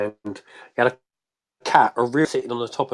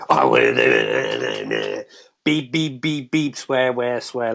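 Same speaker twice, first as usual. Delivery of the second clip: mock impression of a modern song, beeped-out swearing; mocking modern music; slower than usual. Music hater sending up today's chart songs to co-host, mocking. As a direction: parody chart music in droning nonsense bursts. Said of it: "like that kind of music".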